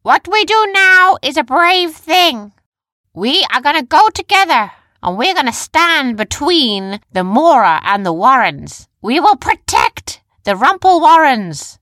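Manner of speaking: high, loud rallying cry; shouted speech. A narrator's reading. Leader urging her army to protect their home, high shouting. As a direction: proclaim it to a whole army. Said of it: "she shouted".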